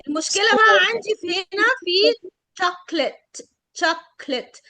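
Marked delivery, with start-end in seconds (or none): 2.6-4.6 chocolate said as CHOK-let, two syllables; swallowed O. Shown as a demonstration. Chocolate نطقها CHOK-let. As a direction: drop the middle O in chocolate for the class. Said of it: "فين ال O؟".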